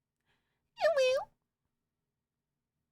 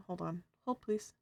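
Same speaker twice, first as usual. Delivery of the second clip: ordinary talk, nothing marked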